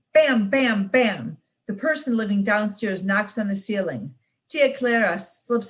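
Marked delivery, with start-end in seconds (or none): 0.1-1.4 knocking. Acted. Neighbour knocks on the ceiling.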